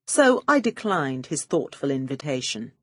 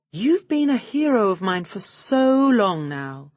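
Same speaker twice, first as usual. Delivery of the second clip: slow gushing flattery, pitched up; butter him up shamelessly; laying it on thick; 4 semitones higher than usual. Acted. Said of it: "I was laying it on thick".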